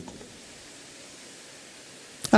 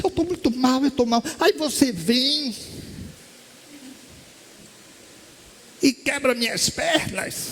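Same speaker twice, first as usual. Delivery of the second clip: complaining woman; 8 semitones higher than usual. Retold or imitated. Distressed woman complains to speaker.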